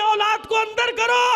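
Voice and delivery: impassioned majlis preacher rousing the crowd; fiery preaching. Acted. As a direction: drive the sermon home at full force. Preacher rising to full force.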